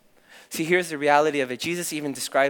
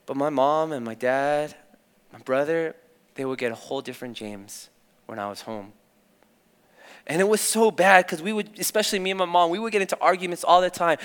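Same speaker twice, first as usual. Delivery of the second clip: ordinary talk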